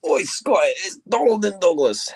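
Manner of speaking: loud, high Scottish call; shouting call. Acted. Calling out as the twins.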